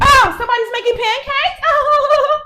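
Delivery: high, loud gushing over pancakes; mock excited. Girlfriend coming downstairs, thrilled about pancakes, high and loud. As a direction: squeal it like a delighted girlfriend. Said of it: "she's like".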